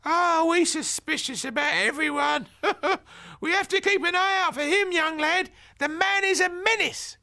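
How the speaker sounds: put-on high, loud gossiping old granny; a chatty grandmother grumbling about the neighbour. A narrator's reading. Grandmother gossiping to Ben about the nosy neighbour, high and loud. Granny voiced in story read.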